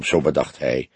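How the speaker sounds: ordinary delivery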